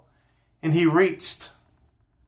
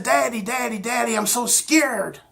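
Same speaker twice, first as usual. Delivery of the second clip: higher, frightened calling for daddy; a boy running home in fright; scared child; 7 semitones higher than usual. Acted. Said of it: "he was startled and he was scared".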